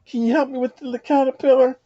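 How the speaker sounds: put-on a worried child asking an old man for help; a little girl begging the old man for help. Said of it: "and she picks up the caterpillar and say".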